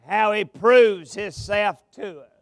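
ordinary talk

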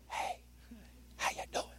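soft whispered greeting. Shown as a demonstration. Preacher whispers a greeting to a brother close by. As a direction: lean in and breathe it. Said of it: "I can say".

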